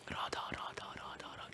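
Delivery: hushed whispered chant; Krishna whispering the name in tears. Retold or imitated. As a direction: breathe the name in secret.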